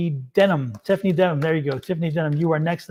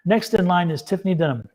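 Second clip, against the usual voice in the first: ordinary talk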